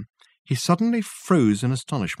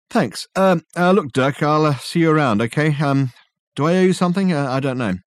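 higher, louder, halting with ums; awkward Richard; 5 semitones higher, 4 dB louder than usual. Acted. Richard awkwardly saying goodbye to Dirk, hesitant. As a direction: fumble the goodbye.

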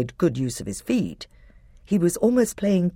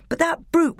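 quick, higher-pitched worried aside; rushed fretting; 12 semitones higher than usual. A narrator's reading. Edmund fretting that Miraz will get up, rushed and higher. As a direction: hurry through it, anxious about what comes next.

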